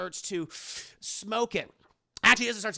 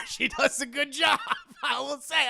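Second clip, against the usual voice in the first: higher, loud, through laughter; laughing; 7 semitones higher, 5 dB louder than usual. Real, not acted. Admitting the twerking girl is good, laughing. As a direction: laugh your way through the words.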